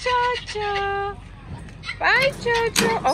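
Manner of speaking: high, loud, drawn-out call; calling to a pet. Real, not acted. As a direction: sing out to the bird, high and slow. Calling goodbye to the bird.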